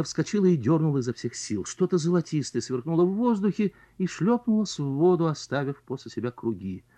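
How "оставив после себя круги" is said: ordinary delivery, nothing marked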